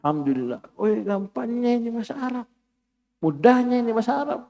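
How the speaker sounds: high, quick gush of relief; acting delighted relief. Teacher voicing a student's joy at easy Arabic. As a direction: sound thrilled it's so easy. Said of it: "antum bilang".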